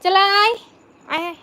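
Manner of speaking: loud, high call across the room. Real, not acted. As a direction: call the daughter over for food. Calling daughter to come eat.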